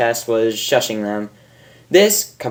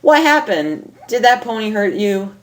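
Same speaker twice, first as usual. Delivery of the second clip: worried mother; 8 semitones higher than usual. Acted.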